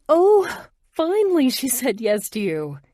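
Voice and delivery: high, loud delighted squeal; happy cheer. A narrator's reading. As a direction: cheer them on with glee. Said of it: "one of the women says happily".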